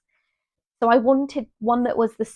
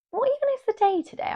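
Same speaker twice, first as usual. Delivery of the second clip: confused; 13 semitones higher, faster than usual. Real, not acted. Confused about the date.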